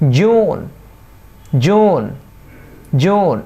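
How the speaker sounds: জুন with a drawn-out "oo"; long vowel. Shown as a demonstration. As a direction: stretch the vowel in জুন, rising then falling. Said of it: "এটা long vowel". (জুন-এ লম্বা স্বরধ্বনি)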